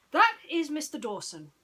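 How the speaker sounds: detective introducing his friend; proud Basil. Acted. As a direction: announce him grandly. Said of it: "Basil said".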